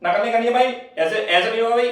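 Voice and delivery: high, loud scolding call; teacher shouting. Retold or imitated. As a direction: yell into the exam hall. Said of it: "भाई ऐसे बोलते रहेंगे".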